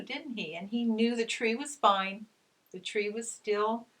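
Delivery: normal talking voice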